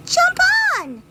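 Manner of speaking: very high, loud squeak; tiny ants calling. Acted. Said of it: "they cried".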